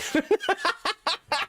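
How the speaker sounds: high-pitched burst of laughter; real laughing fit. Real, not acted. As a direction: crack up at what you just read.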